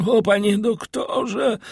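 high, slow wail of distress; desperate appeal to the doctor; Barua calling out. A narrator's reading. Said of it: "zawołał Barua". (wysokie, przeciągłe wołanie o pomoc)

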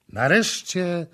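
plain reading voice

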